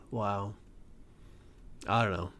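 low, hushed resignation; deflated. Real, not acted. Host spent after ranting at people, deflated. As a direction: drop low and soft, all steam gone.

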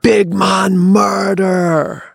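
high, drawn-out holler; imitated long alarm cry; sudden yell. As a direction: bellow it slowly across a valley. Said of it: "all of a sudden he yells".

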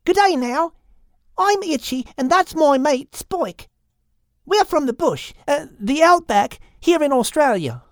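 high, friendly Aussie lizard; high Australian lizard, welcoming; Itchy the lizard. Acted. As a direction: greet them high and cheery, outback style. Itchy introduces herself.

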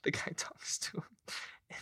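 breathy whispered aside; whispering. Real, not acted. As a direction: drop to a whisper mid-story. Aside while laughing at story.

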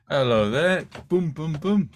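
lightly mocked loud stage greeting; hammy comic. Mock comic opening a set on stage, hammy. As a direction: belt out a little showbiz patter.

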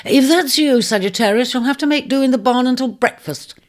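high, brisk inhospitable call. Acted. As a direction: call through the door to an unwanted visitor. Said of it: "she called".

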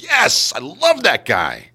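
high, slow delighted cheer for the fan; excited player. Retold or imitated. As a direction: gush about your favourite fan. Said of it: "the Knicks ... are like".

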